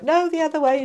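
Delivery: playful bossy correction from the cards; mock insistent cards. Cards telling her to flip them, mock bossy. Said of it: "they're like".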